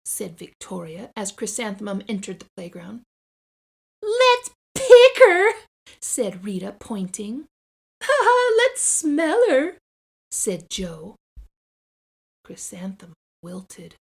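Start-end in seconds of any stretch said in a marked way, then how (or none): 4.0-5.5 very high, loud, slow taunt; teasing kid. Acted. Rita mocking Chrysanthemum on the playground, very high and slow. 8.0-9.8 put-on high, loud jeering child; mocking laugh. Joe jeering at Chrysanthemum, high and loud.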